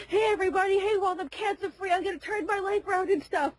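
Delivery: high-pitched, mock-jubilant announcement; happy cheering. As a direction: imitate a man cheering his good news. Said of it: "He's cheering and everything".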